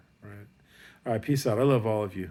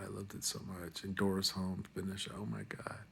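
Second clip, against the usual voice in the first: whisper; 12 dB quieter than usual. Real, not acted. Awed memory of teacher.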